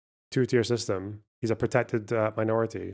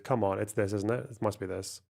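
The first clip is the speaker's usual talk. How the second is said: ordinary talk, nothing marked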